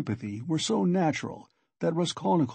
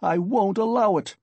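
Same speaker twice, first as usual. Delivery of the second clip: furious narrated shout, higher pitch; raised, higher voice of outrage; 7 semitones higher, 5 dB louder than usual. A narrator's reading. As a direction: burst out in indignant protest. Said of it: "he shouted".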